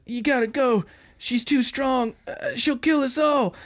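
high, strained hammed-up desperation; mock panicked warning. Bloodied gangster warns his waiting gang off, mock panicked warning. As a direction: plead with them to flee, theatrically.

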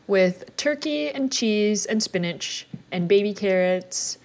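slow, savoring list; drawn-out menu rundown. Real, not acted. Slowly lists lunch items.

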